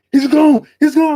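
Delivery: very high, loud urgent yell; alarmed shout. Retold or imitated. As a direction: raise the alarm as he bolts. Thief runs off.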